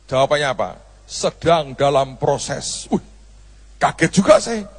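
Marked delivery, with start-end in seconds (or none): 1.1-2.9 slow evasive reply. Retold or imitated. Boy's evasive answer about passport.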